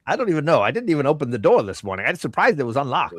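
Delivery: lively, lilting shopkeeper patter; chatty shopkeeper, amused. Acted. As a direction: play a scatterbrained merchant, lightly voiced.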